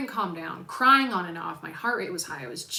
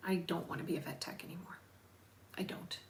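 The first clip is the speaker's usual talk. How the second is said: whispered; 2 semitones lower, 11 dB quieter than usual. Retold or imitated. Telling husband she wants to quit.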